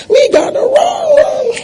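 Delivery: very high, drawn-out falsetto; playful falsetto cry. Pretend. Preacher hurrying the congregation along, playful falsetto cry. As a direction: stretch it out high and slow, playfully.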